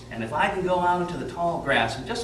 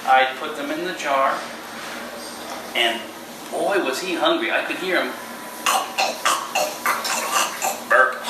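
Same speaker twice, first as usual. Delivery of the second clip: on stage. Acted. Storyteller performing for children.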